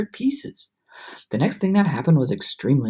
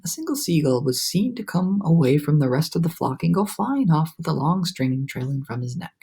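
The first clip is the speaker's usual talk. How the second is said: plain reading voice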